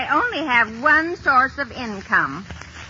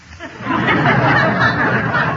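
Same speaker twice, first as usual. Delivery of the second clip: laugh; 8 semitones higher, 4 dB louder than usual. Acted. [name] laughs at her joke.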